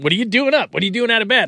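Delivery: mock parent scolding. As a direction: play a fussy parent catching a kid up late. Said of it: "I didn't ask her what she was doing up".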